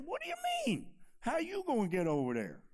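high, startled disbelief; a fisherman baffled by the order; incredulous question. Retold or imitated. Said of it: "somebody probably turned to him and said".

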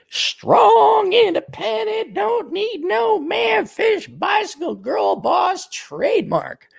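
very high, drawn-out falsetto; mock girl-boss slogan voice. Man parodying strong independent women, high mocking voice. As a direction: chant it slowly in a squeaky mocking falsetto.

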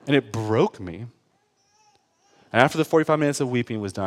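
ordinary delivery

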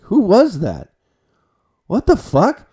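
high, loud, baffled outburst; retold freak-out. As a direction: act out being loudly thrown by a stranger. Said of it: "freaking out".

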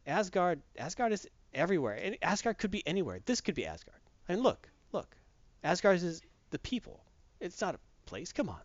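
soft, hushed imitation of a dying father; lightly played last words, quietly spoken; Odin. Dying Odin explains Asgard to his sons, softly. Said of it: "he basically tells them, I'm dying".

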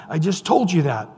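usual speaking voice

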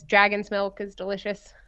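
normal talking voice

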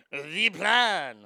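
"The plan" in a mock French accent, "plan" falling; fake French accent. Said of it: "She used a fake French accent".

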